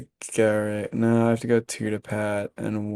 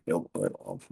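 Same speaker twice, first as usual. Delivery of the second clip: mumbling; 8 dB quieter than usual. Real, not acted. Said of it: "I'm mumbling to myself".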